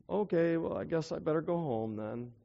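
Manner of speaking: a beggar quietly giving up and heading home; resigned giving up. Retold or imitated. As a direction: let the air out, defeated and quiet. Giving up, told off by crowd.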